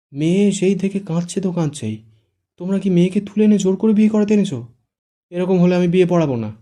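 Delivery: an outraged elder refusing to go on; loud scandalised objection from the cleric; indignant protest. Acted. Cleric objects to weeping bride.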